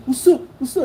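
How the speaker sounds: high, loud mock challenge; imitated fight challenge. Shown as a demonstration. Rival squaring up at the window, imitated high challenge. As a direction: puff up and taunt, imitating a would-be fighter. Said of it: "That's what he was doing".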